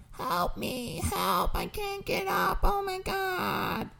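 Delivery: put-on very high, wailing old lady cry for help; old lady screaming. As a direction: shriek like a fallen old woman. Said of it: "an old lady screaming".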